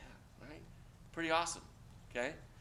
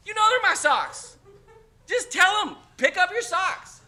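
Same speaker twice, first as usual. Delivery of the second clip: exasperated loud; 13 dB louder, slower than usual. Real, not acted. Teacher rants about hints, loud.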